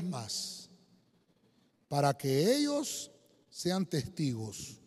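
slow Bible verse, phrase by phrase; deliberate pulpit reading with long pauses; solemn scripture reading. Acted. Reads Bible verse aloud.

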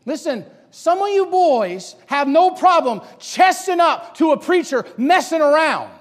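loud, high, slow scolding; full-force preaching. Real, not acted. Preacher scolding boys who challenge authority, full-force preaching. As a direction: rebuke them from the pulpit.

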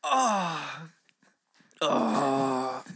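coughing after choking on coffee; strained, recovering from choking. Real, not acted. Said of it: "[name] is currently choking".

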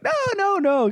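high, mock-naive brush-off; dismissive. Imitating naive visitors answering their friend, dismissive. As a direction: wave the warning away in a high voice.

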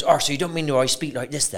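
imitating a broad Bristol pirate accent. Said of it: "he starts speaking in the accent".

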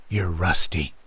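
low, cold understated remark; cold and haunting. A narrator's reading. Man with the blade to the narrator, cold and haunting. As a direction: say it with quiet menace. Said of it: "cold and haunting".

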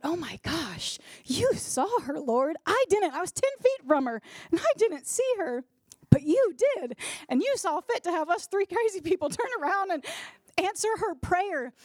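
high, emotional outpouring to God; awestruck prayer. Retold or imitated. Woman telling the Lord He saw her, awestruck. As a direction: marvel aloud, voice lifting high and loud.

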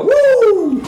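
high, loud cheering whoop; excited whoop. Real, not acted. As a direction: let out a big whoop. Cheering at food pitch.

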